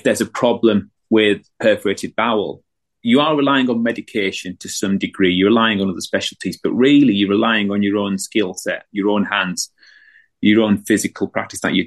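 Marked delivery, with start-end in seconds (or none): none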